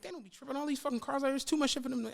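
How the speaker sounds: high, hushed reassurance; casual coaxing. Retold or imitated. Friend dismissing worries about police, soft high coaxing. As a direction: imitate a friend softly brushing off the danger.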